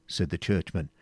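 ordinary delivery, nothing marked

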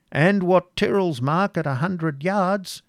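high, slow taunting call; heckler from behind. A narrator's reading. Unseen voice taunting the prince from behind, high drawn-out call. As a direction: call it out from the back of a crowd.

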